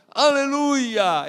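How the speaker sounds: long drawn-out Aleluia, high and very loud; joyful shout. Retold or imitated. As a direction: cry Aleluia out in praise. Ecstatic worship shout.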